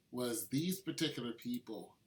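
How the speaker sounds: ordinary talk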